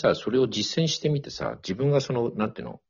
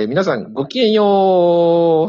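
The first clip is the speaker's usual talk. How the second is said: put-on haughty rich girl japanese; 8 semitones higher, 11 dB louder, much slower than usual. Playful sign-off at show end.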